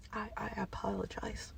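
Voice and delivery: hushed whispered apology. Real, not acted. Sorry to viewers after yelling, whispered. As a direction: drop to a sheepish whisper.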